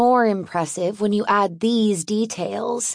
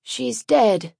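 numb, emotionless statement. Acted. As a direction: state it hollow, in shock. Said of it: "in a voice that lacks any emotion".

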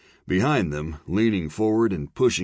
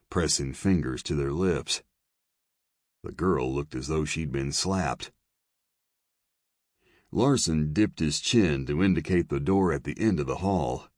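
plain reading voice

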